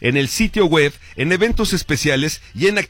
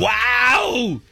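excited; 7 semitones higher than usual. Real, not acted. Reacting to a concert announcement.

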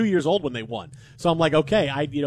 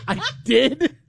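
high, laughing disbelief; laughing surprise; 6 semitones higher than usual. Retold or imitated. Host realizing his own mistake, laughing surprise. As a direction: gasp it out through a laugh. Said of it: "And I'm like".

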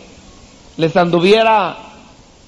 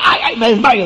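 mock fainting; 3 semitones higher than usual. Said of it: "decía ella".

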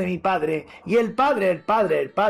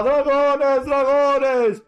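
higher and louder, frightened townsfolk; panicked cry; 8 semitones higher, 4 dB louder, much slower than usual. Acted. Frightened townsfolk crying out at the dragons, alarmed. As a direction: yell in alarm at the sky.

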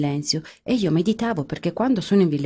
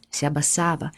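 usual speaking voice

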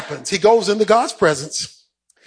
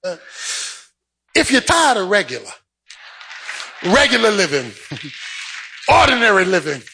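ordinary talk, nothing marked

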